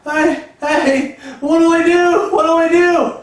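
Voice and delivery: very high, loud, panicked cry; panicked girlish shriek. Retold or imitated. Agent begging the farmer for help, high panicked shriek. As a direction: shriek for help like a terrified little girl. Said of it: "screaming like a little girl".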